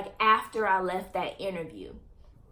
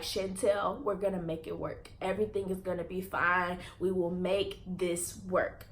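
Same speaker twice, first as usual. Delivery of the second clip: reassuring mother; 3 semitones lower, 6 dB quieter than usual. Retold or imitated. Mother reassuring her daughter.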